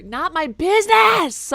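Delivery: loud, slow, drawn-out outburst; dramatic yell. Acted. Player reading a game line, dramatically shouted. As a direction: belt it out like a stage actor. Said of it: "How else am I supposed to read it?".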